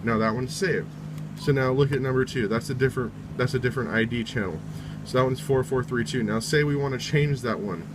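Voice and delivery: ordinary delivery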